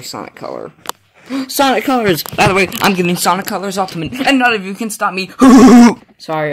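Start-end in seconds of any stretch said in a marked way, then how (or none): 1.3-2.2 gasp, then blurt it out high; sudden delighted realisation; excited gasp. Real, not acted. 2.2-5.3 fast, loud gloating boast; megalomaniac rant. Real, not acted. Defiant boast, then apologises. 5.4-6.0 loud laugh. Real, not acted. Laughs after the boast.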